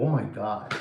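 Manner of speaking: low, soft awed oh my God; hushed shocked gasp. Retold or imitated. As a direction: murmur it in stunned disbelief. Imitates own shock.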